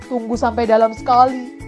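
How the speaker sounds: loud, measured crab reply; a hint of a crab giving the signal; lightly voiced crab. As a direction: answer firmly in code. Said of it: "Si kepiting menjawab".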